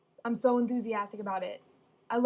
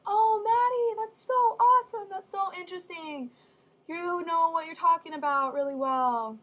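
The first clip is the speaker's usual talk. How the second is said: much higher, louder mock praise; gushing teacher; 7 semitones higher, 6 dB louder than usual. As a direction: rave like an impressed teacher. Teacher praising her paper.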